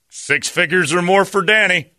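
high, slow proud brag; proud dad. Retold or imitated. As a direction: boast it like a proud father. Dad bragging on the form.

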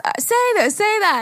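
high mock child voice; high-pitched pretend child; little girl. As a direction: imitate a kid rattling off prompts. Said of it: "And then she goes".

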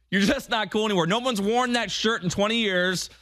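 ordinary delivery

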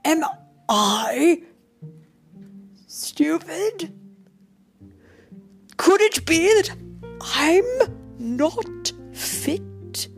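high, halting, incredulous questioning; dismayed thought. A narrator's reading. Emperor doubting his fitness to rule, dismayed. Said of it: "thought the emperor".

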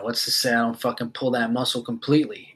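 ordinary delivery

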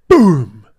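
shouted boom imitating a slap; imitating the smack of a slap on the back; loud slap sound effect.

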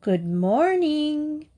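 high, long-drawn greeting; calling out. A narrator's reading. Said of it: "called out".

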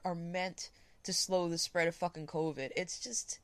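ordinary talk, nothing marked

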